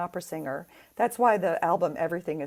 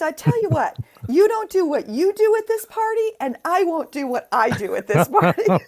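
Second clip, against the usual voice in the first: higher, louder punchline with a laugh creeping in; witty comeback; 9 semitones higher, 7 dB louder than usual. Retold or imitated. Mezzo's comeback to the drunk guest, laughing through it. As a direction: deliver the zinger high and bright. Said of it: "she says".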